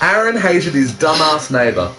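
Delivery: ordinary talk, nothing marked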